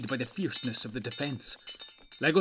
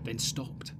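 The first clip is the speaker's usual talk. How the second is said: usual speaking voice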